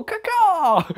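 very high, loud hawk cry; hawk call. Retold or imitated. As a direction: screech it like a hawk. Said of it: "we all go".

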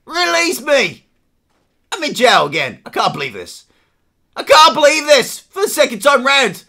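normal talking voice

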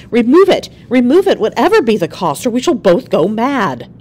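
loud, high, desperate plea; crying out. A narrator's reading. Wife begs husband to remove the birthmark, crying out desperately. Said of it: "cried Georgina".

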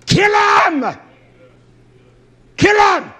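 very high, full-force shout; screamed command. Acted. Preacher voicing God's answer to the plea, screamed command. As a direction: scream each order as a separate blast.

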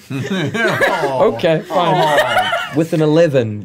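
laughing through the words; giggling while ruling. Real, not acted. GM cracking up mid-ruling.

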